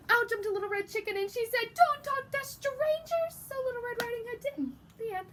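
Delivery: high squeaky little chicken voice. Acted. Teacher voicing the interrupting chicken, high and chirpy. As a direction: pipe up like a bossy little chicken.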